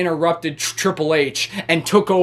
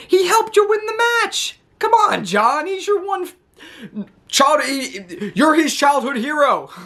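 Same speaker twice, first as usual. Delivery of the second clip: pleading; 8 semitones higher than usual. Real, not acted. Pleading with Cena about R-Truth.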